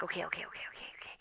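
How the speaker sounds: breathless whispered okays calming herself; frantic whisper. Retold or imitated. Knocking on the neighbor's window, whispering to herself. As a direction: whisper the okays to steady yourself. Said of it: "I'm like".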